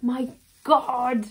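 excited gasp. Real, not acted. Opening the miniature Slughorn hourglass, loud awed gasp. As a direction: let it burst out in stunned excitement.

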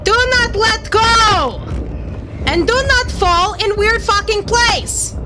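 high, slow, emphatic orders; stern scolding. Real, not acted. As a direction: lay down the law word by word. Scolding the game character.

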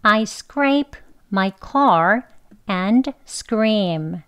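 very slow, each word of car drawn out; slow book pronunciation. Shown as a demonstration. Teacher slowly reads the textbook version to the students. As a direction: stretch I scrape my car slowly and fully. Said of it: "천천히 우선 책 발음".

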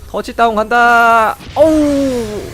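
high, loud, drawn-out announcer call; touchdown call. Acted. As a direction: belt it like a sports announcer. Hyped call charging in game. (신나게 외치는 터치다운 콜)

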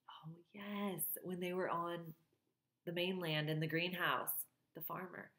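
soft whispered aside; whispering. Real, not acted. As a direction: whisper it as a reminder. Reader's quiet aside to listener.

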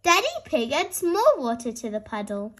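ordinary delivery

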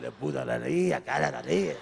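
low, slow, then shouted fake Spanish babble; hammed-up mock Spanish shout; mock Spanish gibberish. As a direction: ham up a Puerto Rican caricature, ending in a yell. Mock Puerto Rican character.

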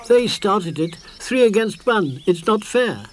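put-on high, loud childish complaint; upset protest. Small creature protests to Moomin Mama, high and upset.

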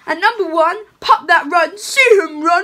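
put-on lively read-aloud for children; storybook reading. Santa reading a picture book to children, sing-song.

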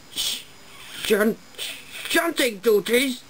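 stammering, high outraged cry; cried out. A narrator's reading. Finch crying out in disbelief at shunting duties. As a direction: choke on it, then cry it out. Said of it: "cried Finch".

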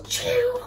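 high-pitched "chew" sound effect; imitated crash. Date landing in tables and chairs, high crash noise. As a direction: mimic glass flying on impact. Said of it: "all you heard was".

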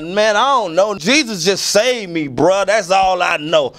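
high-pitched, animated self-quote; pleading simplicity. Retold or imitated. Singer answering theology debaters, earnest and insistent. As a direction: protest it earnestly, voice lifted high. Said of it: "I'm like".

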